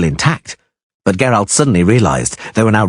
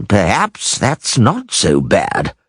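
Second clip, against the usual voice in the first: musing; much slower than usual. A narrator's reading. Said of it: "Gillenstiern wondered".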